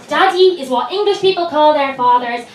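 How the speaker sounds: normal talking voice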